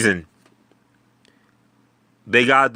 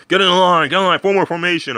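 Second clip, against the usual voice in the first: battlefield command shout; 11 semitones higher, 5 dB louder than usual. Retold or imitated. General rallying his soldiers before the Dothraki hit, loud high shout. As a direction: bark it high and loud at the troops. General ordering troops into line.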